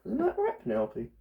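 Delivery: high sing-song question to the snake; high pet talk. Real, not acted. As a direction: coo at her pet. Cooing to the snake.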